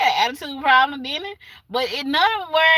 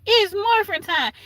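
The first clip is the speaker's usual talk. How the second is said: catchphrase call; 9 semitones higher than usual. Retold or imitated. Quoting Power Rangers catchphrase.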